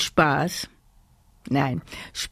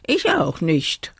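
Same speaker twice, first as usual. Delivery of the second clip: usual speaking voice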